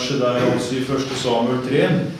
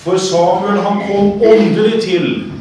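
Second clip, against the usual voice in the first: raised, forceful sermon voice; preaching; 8 semitones higher, 5 dB louder than usual. Real, not acted. Preacher expounding the story of old Eli and Samuel, forceful preaching. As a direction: preach it loudly, leaning hard on key words. Preaches on the text just read.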